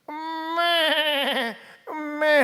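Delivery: 높고 길게 늘인 양 울음 흉내; 매애 하고 우는 양처럼 끌어; sheep bleat imitation. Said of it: "순양 그렇게 안 우는데요?". (걸린 양의 울음을 흉내 낸 음메)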